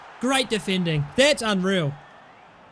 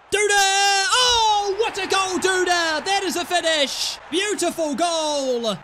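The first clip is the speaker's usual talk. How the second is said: goal celebration; 8 semitones higher, slower than usual. Real, not acted. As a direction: yell it out as the ball hits the net.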